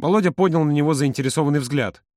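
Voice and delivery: ordinary delivery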